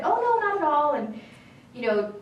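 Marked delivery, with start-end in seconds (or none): 0.0-0.9 high, loud, over-ready reassurance; mock innocence. Reassuring the boss from rules, mock innocent. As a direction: protest innocently, voice pitched well up.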